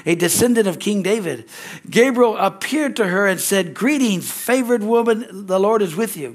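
scripture reading. Acted. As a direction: read the verses out to the congregation. Reads Luke passage aloud.

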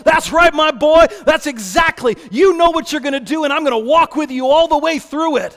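a loud, proud father's encouragement; fatherly affirmation. Acted. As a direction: cheer your son on. God affirms Jesus.

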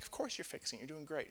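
put-on quiet, humoring encouragement to a toddler; reassuring toddler. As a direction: hush it down, soothing a little kid. Reassuring toddler hitting table.

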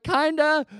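put-on high, loud little-boy answer; reluctant admission to dad; imitating his child self; sheepish kid. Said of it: "I was like".

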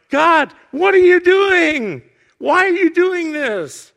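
loud, high, drawn-out questioning of God; anguished outcry. Retold or imitated. Psalmist demanding answers from God, in loud anguished protest. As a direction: cry out in protest, stretching the words.

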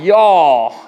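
loud, drawn-out Texas country twang; playful Texas twang. Acted. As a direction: stretch it like a Texas country boy. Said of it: "have that Texas twang in his voice".

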